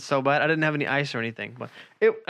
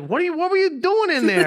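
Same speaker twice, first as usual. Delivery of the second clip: much higher, louder mock girlfriend voice; 6 dB louder than usual. Boyfriend mimics girlfriend's question, high mocking voice. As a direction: squeak it out like you're imitating her. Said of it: "she's like".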